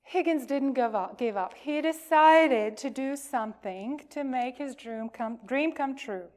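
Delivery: ordinary delivery, nothing marked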